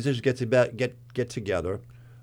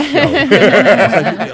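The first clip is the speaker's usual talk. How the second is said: laughing; 9 semitones higher, 15 dB louder than usual. Real, not acted. Laughing while mocking old singer.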